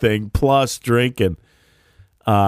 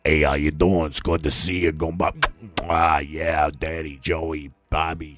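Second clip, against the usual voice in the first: put-on lower, softer Brooklyn accent, greeting pals; a low, easy Brooklyn greeting as Phil; Brooklyn driver voice; 7 semitones lower, 6 dB quieter than usual. Phil greeting the limo drivers, in a low, soft Brooklyn accent. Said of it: "He starts, like, kissing them".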